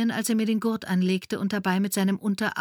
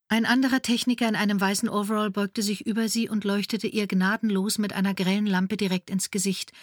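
ordinary talk

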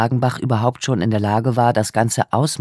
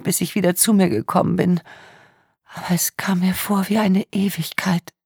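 put-on tearful young woman; 7 semitones higher than usual. A narrator's reading. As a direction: voice a traumatised young woman in tears. Tearful victim speaks.

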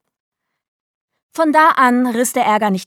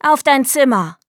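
louder, higher stern order; commanding; 5 semitones higher, 5 dB louder than usual. A narrator's reading. Mother sending Delly to her room, firm command. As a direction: order a child off firmly. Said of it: "befahl Clarice".

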